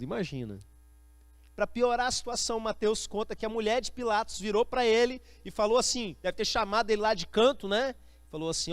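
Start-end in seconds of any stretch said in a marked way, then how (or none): none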